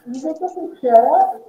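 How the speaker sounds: to someone hard of hearing. Real, not acted. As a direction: speak up over a bad connection. Checking the volume.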